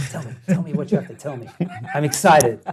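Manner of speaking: imitating an excited, eager welcome. Dalai Lama urging his visitor to speak, eager. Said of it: "He's like".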